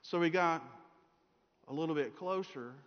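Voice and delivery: ordinary talk, nothing marked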